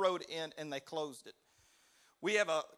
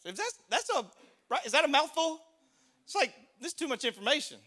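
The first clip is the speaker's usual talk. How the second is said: higher, louder incredulous aside; mock bafflement; 9 semitones higher, 6 dB louder, faster than usual. Preacher reacting to too much information, mock bafflement. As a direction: act baffled by a mouthful, pitched up.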